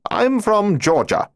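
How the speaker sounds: put-on a German bluffing a Georgia origin in German accent. A narrator's reading. Lieutenant answering the cop, in a light German accent. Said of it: "spoken in his German accent".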